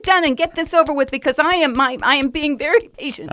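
strained, fast, high forced calm; through-the-teeth patience, rushed; fake patience. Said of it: "you spend a lot of time in denial going".

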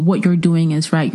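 ordinary talk

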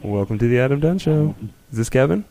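ordinary talk

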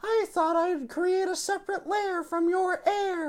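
put-on high, confident young woman; Jolene voice. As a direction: explain the plan with smug confidence.

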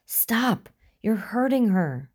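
high-pitched desperate plea. A narrator's reading. Begging the attacker to release his choking friend, desperate.

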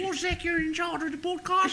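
very high granny voice; nan impression. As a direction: coo it like a hammed-up grandma. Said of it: "doing impressions of James's nan".

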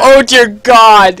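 slow, loud drawn-out dismay; shocked exclamation. Real, not acted. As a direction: drag it out in disbelief. Shocked at results screen.

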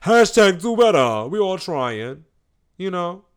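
loud, high, drawn-out hungry whine. Acted. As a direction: whine it like you're starving. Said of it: "That's my hungry voice".

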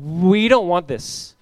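slow, loud, stretched-out objection; mock outcry with a long swooping first word; acted protest. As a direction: drag out the crowd's complaint loudly.

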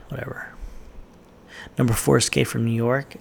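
normal talking voice

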